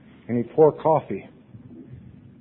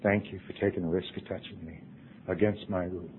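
quiet thanks; 4 semitones lower, 11 dB quieter than usual. Retold or imitated. Quoting unspoken thanks.

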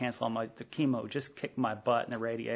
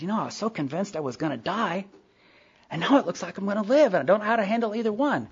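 emotional outburst; 8 semitones higher, 5 dB louder than usual. Retold or imitated. Telling sponsor of his fears.

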